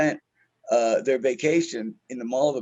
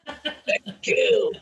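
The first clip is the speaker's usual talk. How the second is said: words broken up by a high laugh; cracking up mid-sentence. Real, not acted. Laughing at his own story.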